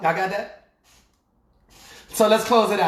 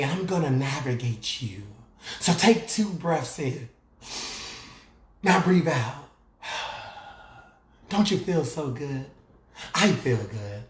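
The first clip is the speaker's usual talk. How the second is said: mock meditation voice; 8 semitones lower, 5 dB quieter than usual. Preacher mocking a soft meditation host, hushed guide voice. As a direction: parody a gentle wellness host. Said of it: "That's not me".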